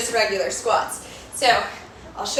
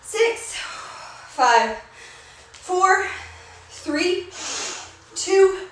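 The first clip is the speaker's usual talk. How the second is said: workout coach counting; 5 semitones higher, slower than usual. Real, not acted. Coach counts reps aloud.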